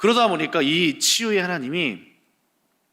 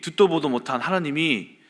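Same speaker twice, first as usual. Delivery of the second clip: ordinary delivery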